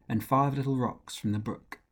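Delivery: ordinary delivery, nothing marked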